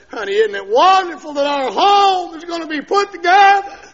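high, loud, overjoyed cry; joyful wife shouting that the home is saved. Retold or imitated. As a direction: burst out with joy for the wife. Wife's joyful words retold.

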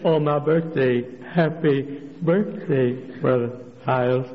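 slow, halting imitation of impaired speech; talks like this. Preacher imitating an ailing church lady, slow and halting. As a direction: labour over each word, syllable by syllable. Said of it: "she talks like this".

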